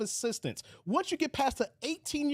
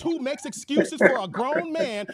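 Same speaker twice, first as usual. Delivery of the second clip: louder, heated insult; 10 dB louder than usual. Real, not acted. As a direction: shout it back. Heated argument, shouting.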